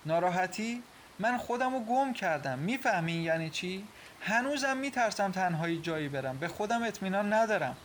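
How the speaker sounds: high-pitched, indignant wounded protest; hurt outburst. Acted. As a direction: throw his sympathy back at him. Acted quarrel, wounded wife confronts husband. (با دلخوری و اعتراض)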